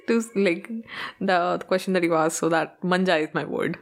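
ordinary talk

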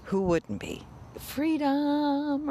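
ordinary talk, nothing marked

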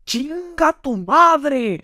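hammed-up angry lawyer cursing; retold furious curse, stretched out high; angry insult. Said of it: "está bien enojado".

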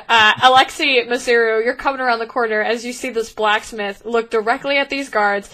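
ordinary talk

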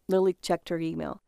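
ordinary delivery

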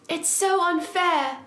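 hammed-up cry of unfairness; yelled complaint. Heroine protesting to Draco about being desired, mild theatrical yell. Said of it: "I yielded".

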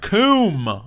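loud sound-effect boom; thunder crack. Pretend. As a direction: shout it like a thunderclap. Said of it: "the sound effects".

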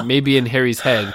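plain reading voice

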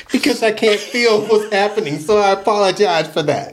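high voice, barely holding back giggles; real laugh caught in the throat; laughter-strained apology. Real, not acted. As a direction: apologize while trying not to crack up. Apologizing amid laughing fit.

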